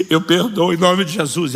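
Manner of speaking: emotional release. Acted. Tearful surrender in forgiveness.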